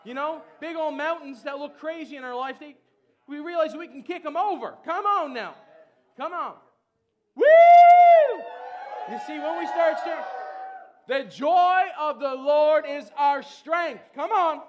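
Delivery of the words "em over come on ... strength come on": loud, slow proclaimed line; full-force preaching. Real, not acted. Preacher declaring joy to the congregation, loud. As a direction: proclaim it to the whole church.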